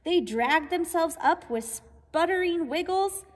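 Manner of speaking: usual speaking voice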